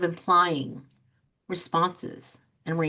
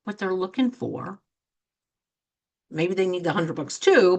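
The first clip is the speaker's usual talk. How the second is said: usual speaking voice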